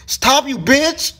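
high, loud, drawn-out protest; exasperated yell. Real, not acted. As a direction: yell at the attacker to quit. Yells at attacking player.

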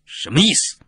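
sharp, high-pitched demand; stern, almost threatening. A narrator's reading. Noble confronting the wandering performer, stern and nearly threatening. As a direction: challenge the stranger severely. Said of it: "用严厉的、 几乎带着威胁的口气问道".

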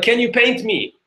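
high, light woman's voice; woman asking. Retold or imitated. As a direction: ask sweetly like a fan. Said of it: "and she's like".

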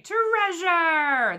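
high, excited pirate holler; shouting about treasure. A narrator's reading. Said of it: "the other shouted".

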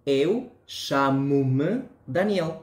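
"Eu chamo-me Daniel" very slow, European Portuguese accent; European Portuguese answer. Shown as a demonstration. Teacher answering the example question, slow European Portuguese accent. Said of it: "yo respondo".

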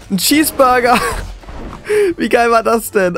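high burst of laughter; laughing. Real, not acted. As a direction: crack up at the funny skin. Laughing at the cheeseburger skin.

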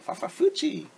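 high, squeaky phew sound; yucky noise at the smelly landfill; disgusted stink noise. Acted. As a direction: react to an awful smell.

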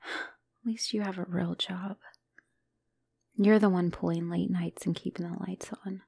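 soft unvoiced whisper, close and hushed; whispered confession. Girlfriend confessing her guilt to her partner, whispering. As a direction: hush it, barely breathing the words to him.

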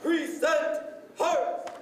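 sharp, drawn-out military command calls; drill commands. Acted. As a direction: call each command crisply to the squad.

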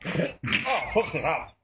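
coughing and spluttering; choking on cinnamon. Real, not acted. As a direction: gasp through a dry burning mouth. After eating a spoon of cinnamon.

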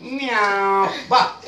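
usual speaking voice

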